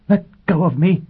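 high, loud cry to be released; alarmed protest. Acted. Robin seized by Foyle in her apartment, alarmed cry. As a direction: cry out and pull free.

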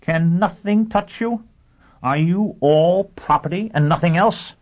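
high, slow, contemptuous and loud; scornful. Acted. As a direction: pour slow contempt on him. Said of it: "asked Pristine scornfully".

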